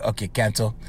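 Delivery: low, soft deflated okay cancel; resigned. Retold or imitated. Son giving up after mother's no, low and soft. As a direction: let the air out, give up. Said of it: "And I'm like".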